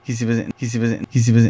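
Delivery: stoned, words drifting. Real, not acted. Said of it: "je suis, je suis défoncé".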